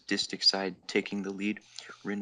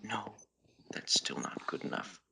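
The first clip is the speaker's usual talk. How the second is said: soft whisper; 2 semitones higher, 4 dB quieter than usual. Acted. Rin denying him softly.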